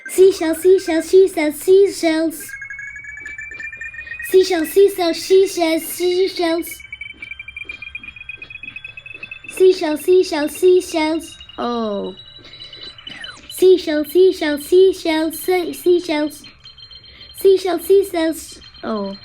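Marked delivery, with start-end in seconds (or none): 0.1-11.4 loud, high, rapid repetition; fast tongue twister. Shown as a demonstration. Racing through the tongue-twister game, fast and loud. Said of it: "You need to say it really fast". 13.5-18.6 loud, high, rapid repetition; fast tongue twister. Shown as a demonstration. Racing through the tongue-twister game, fast and loud. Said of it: "You need to say it really fast".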